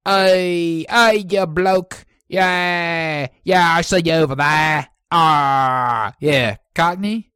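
loud, drawn-out mock Cockney; Cockney accent. Shown as a demonstration. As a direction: call out like a hammed-up Cockney bloke. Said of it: "You want the Cockney?".

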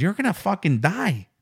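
put-on Mexican accent; Mexican accent impression. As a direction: repeat the driver's line in his Mexican accent. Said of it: "stereotypical Mexican accent".

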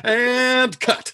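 high, loud call of a film director; mock director's call. Host pretends to end the take, mock director's call.

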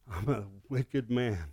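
soft, slow "wicked man"; hushed confession. Retold or imitated. Pastor praying alone, softly afraid. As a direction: breathe it out in fear.

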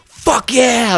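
high, excited cheer. Retold or imitated. Paramedic hearing tones go out for an accident, excited. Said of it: "I'm all excited".

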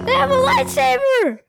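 very high play-acted cry; mock panic. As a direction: squeal the warning in pretend panic. Repeats pretend alarm much higher.

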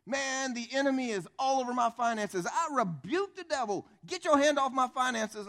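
high, loud, hammed-up declaration. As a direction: imitate a fervent devil-rebuker. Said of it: "Christians love to do this thing".

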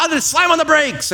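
high-pitched panicked driver; exaggerated sudden alarm, pitch leaping up; mock panic. Driver spotting a traffic light camera, mock panic.

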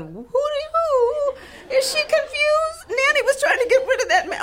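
high, loud teasing hoot; mock disbelief. Relatives hooting at aunt's remark.

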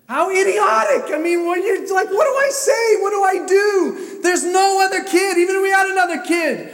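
very high, loud desperate outburst; anguished shouting. Retold or imitated. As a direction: shout it as a parent beside himself. Relives panic over lost daughter.

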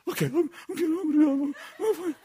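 high, stammering mock-anxious voice; flustered agreement. Gary agrees, flustered, in retelling.

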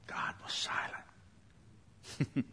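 drawn-out, very soft whisper; slow whisper. Real, not acted. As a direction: let it fade to a bare whisper. Same line repeated in a whisper.

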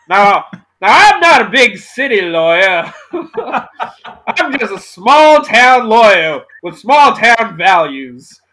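a small-town Southern lawyer, played for laughs. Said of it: "a Southern lawyer".

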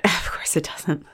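slow, breath-only muttering; hushed, voiceless aside; resigned whisper. Acted. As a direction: whisper it slowly to yourself. Player resigned to clinging spider.

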